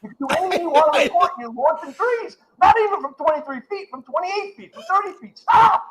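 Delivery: high, exasperated fan rant; yelling at the screen. Retold or imitated. Knicks fan yelling at Tatum on TV, exasperated yell. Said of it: "I'm yelling, I'm like".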